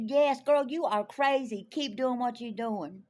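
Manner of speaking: loud, high, slow hollering; cheering someone on. Retold or imitated. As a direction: imitate an excited fan's encouragement. Acts out a woman encouraging her.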